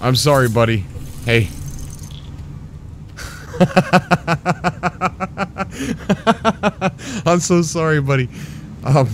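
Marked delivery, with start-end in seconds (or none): 1.5-7.2 giggling laugh. Real, not acted. Laughing at broken ship.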